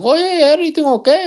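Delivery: high, bright solicitous waiter; lightly hammed-up server; eager waiter. Waiter checking on him at the table, high eager.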